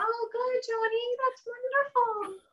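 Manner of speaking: high, soft, slow cooing; imitated parent praise. Imitates parent's reply to child.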